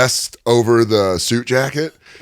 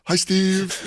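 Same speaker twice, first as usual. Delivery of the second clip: 1 meek awkward hi; 10 semitones higher, 5 dB quieter than usual. Retold or imitated. Awkward Zoom greeting.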